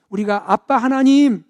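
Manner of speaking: normal talking voice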